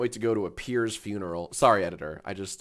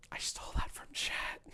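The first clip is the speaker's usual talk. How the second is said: unvoiced, soft aside; whisper; 14 dB quieter than usual. Real, not acted. Streamer whispering an aside to chat. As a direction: breathe it under the mic.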